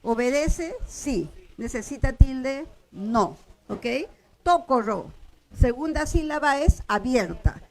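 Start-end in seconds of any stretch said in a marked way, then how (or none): 4.4-5.1 Tokoro with the force on "To"; stress on first syllable. Shown as a demonstration. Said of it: "acentúo la primera".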